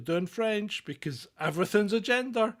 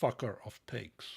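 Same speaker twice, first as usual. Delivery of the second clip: low, softer dry insult; muttered aside; 7 semitones lower, 8 dB quieter than usual. Acted. Insulting the politician to co-host, low and dry. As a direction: drop it under your breath.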